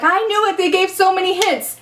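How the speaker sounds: high-pitched mimicry of smug fans; mocking know-it-alls. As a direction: parody people claiming they knew. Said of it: "they're all like".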